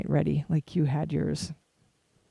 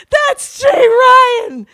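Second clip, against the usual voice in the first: very high, loud fan cry; starstruck shriek; 9 dB louder than usual. Retold or imitated. Kid meeting a rock-star hero, starstruck shriek. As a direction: imitate an overwhelmed squeal.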